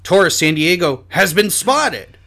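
big, high, slow mystery announcement; loud mock-dramatic bulletin; game-show announcer. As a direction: belt out the suspenseful reveal like a game-show host.

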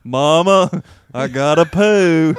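loud, drawn-out mock voice. Friend hams up the buddy's bathroom text, slow mock accent. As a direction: stretch each word in a silly put-on accent. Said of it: "It's a little bit slower".

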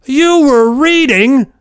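very high, loud, slow incredulous yell; retold yelling. Principal yelling at the student who was reading directions. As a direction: drag out the disbelief at full volume. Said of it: "He just keeps yelling".

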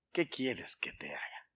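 low, near-whispered, tender question; hushed question. Retold or imitated. Jesus asks the blind man what he wants, hushed. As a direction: drop right down to a soft, low murmur.

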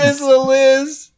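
high, slow cartoon title call. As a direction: sing out a cartoon name.

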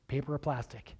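low, soft, worn-out cashier drone; bored repetition. Retold or imitated. Cashier droning the same question to every customer, bored. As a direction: mumble it like the hundredth time today. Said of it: "ask people the same question over and over".

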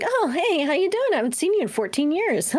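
high-pitched mock-casual greeting; Janelle. Co-host imitating houseguests bumping in the hallway, high mock-casual greeting. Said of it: "and Janelle's like".

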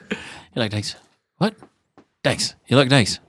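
British tough-guy film impression, clipped exchanges with pauses; understated London action-star voice; Jason Statham. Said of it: "That's a really good Jason Statham".

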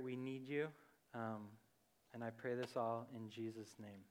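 soft, hushed, reverent prayer; quiet prayer. Acted. Opening prayer before the talk.

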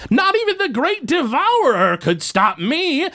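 high, slow boastful goblin voice; megalomaniac rant. Acted. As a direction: declare your own greatness grandly. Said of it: "I am Muck Lord Blorgzak".

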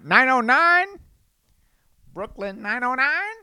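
playful jingle. Acted. Riffing on the time for viewers, playful jingle. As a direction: sing it out like a TV jingle. Brooklyn nine-nine riff.

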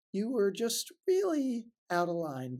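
usual speaking voice

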